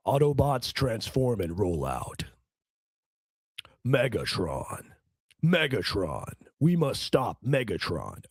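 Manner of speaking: deep, slow, heroic robot commander; Optimus Prime impression. Doing an Optimus Prime voice for the chat, deep and slow. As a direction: boom it out slow and noble.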